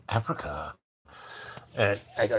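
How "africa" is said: low, far-off puzzled echo of the word; quiet disbelieving echo; surprised question. Retold or imitated. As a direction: repeat it back in disbelief, lower. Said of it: "He goes".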